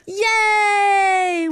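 loud high joyful yay; cheering. Acted. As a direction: cheer with delight. Mouse freed from snow.

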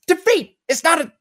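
hammed-up tough karate coach laying down the law; Cobra Kai sensei impression. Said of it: "he's the coach of the Cobra Kai".

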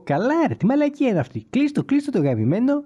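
high-pitched, imitated exasperation; mock annoyed listener. A stray listener rejecting the podcast, high mock annoyance. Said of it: "να είπε το κλασικό".

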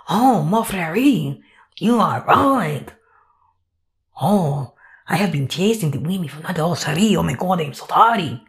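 low, hammed-up French accent; bad French accent. Pepe admitting fault to his therapist, bad French accent. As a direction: confess remorsefully in a fake French accent. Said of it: "I don't have a good French accent voice yet".

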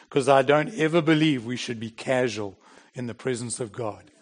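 usual speaking voice

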